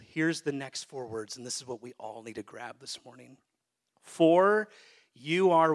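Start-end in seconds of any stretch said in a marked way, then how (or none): 4.0-5.7 loud call out. Shown as a demonstration. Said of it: "Say it louder".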